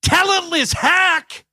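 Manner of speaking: high-pitched, hammed-up wrestling promo; MJF. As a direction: sneer it out, stretching the insult at the end. Acting out MJF's promo.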